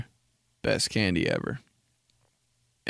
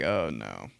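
deep dreading oh no; low dread; 10 semitones lower, 6 dB quieter than usual. Retold or imitated. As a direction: groan it out low. Reacting to dad's suggestion.

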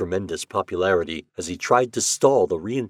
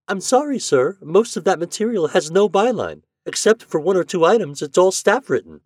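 a much higher, polite woman's voice; human female voice; 11 semitones higher, 6 dB louder than usual. A narrator's reading. Librarian answers Shandor's call, polite female voice. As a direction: sound like a helpful librarian on the line. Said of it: "A female voice, quite human".

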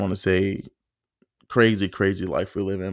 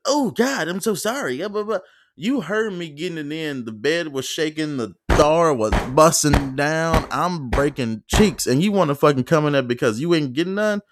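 retold angry rant; 8 semitones higher, 4 dB louder than usual. To the roommate who walked in: high, loud angry rant. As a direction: blow up at the roommate, words pushed out.

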